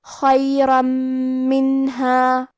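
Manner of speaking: "خیرا منہا" chanted slowly, heavy kha, long nasal doubled m; ghunnah and tashdeed. Shown as a demonstration. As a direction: merge into a held humming m in "خیرا منہا". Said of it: "غنہ اور تشدید کے ساتھ ادا کریں گے". ("خیرا منہا" میں غنہ اور تشدید)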